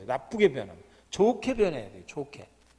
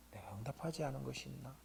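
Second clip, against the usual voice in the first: hushed whispered question; 3 semitones lower, 16 dB quieter than usual. Real, not acted. Preacher leading the congregation to ask quietly, whispering. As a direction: ask it as a private whisper to yourself.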